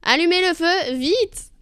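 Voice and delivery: high, loud command from the little pig; urgent order. Acted. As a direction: call out the order in a hurry. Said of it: "au sage cochonnet qui ordonna".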